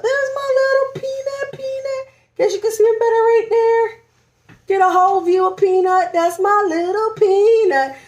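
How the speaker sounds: high tender mommy voice. Real, not acted. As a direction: dote on the bump on camera. Showing bump, cooing.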